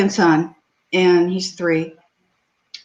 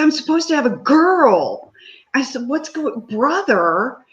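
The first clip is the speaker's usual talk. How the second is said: raised pitch in mock disbelief; protest, surprised and higher; 7 semitones higher than usual. Mother retells surprised protest.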